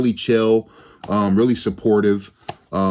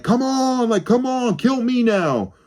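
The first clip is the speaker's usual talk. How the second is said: much higher desperate mock yell; yelling at the sky; 12 semitones higher than usual. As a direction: beg the sky to end it, voice pitched way up. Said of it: "He's yelling at the sky".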